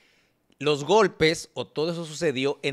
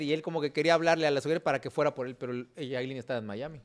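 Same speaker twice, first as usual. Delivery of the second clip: usual speaking voice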